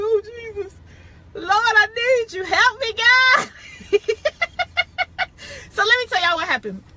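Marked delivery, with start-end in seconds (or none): 0.0-3.5 high, rising cry of "Help me, God"; mock desperate prayer. Resisting temptation, a high pleading mock prayer. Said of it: "I'll be like". 3.5-5.7 a high, breathy burst of laughter. Real, not acted. After the mock prayer, a high giggle.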